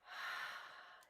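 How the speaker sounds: one long, slow exhale; slow breathing. Shown as a demonstration.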